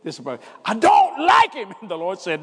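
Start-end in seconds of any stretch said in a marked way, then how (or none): 0.6-1.7 high, loud and slow complaint; frustrated outburst. Retold or imitated. Preacher venting to God about a church member, loud high. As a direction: blurt it out to God.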